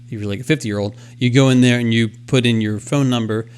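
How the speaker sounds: ordinary delivery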